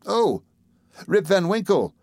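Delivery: light up as it clicks; surprised exclamation. A narrator's reading. Villagers spotting the name they know, surprised. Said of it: "exclaimed two or three".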